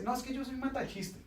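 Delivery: playful self-mockery; mock boast. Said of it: "que uno dice".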